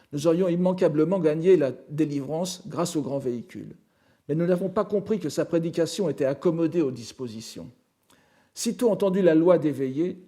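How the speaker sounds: firm, projected sutra recitation; reading scripture aloud. Acted. Lecturer citing Shariputra's address to the Buddha, projected reading voice. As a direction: declaim the disciple's confession as a quoted text.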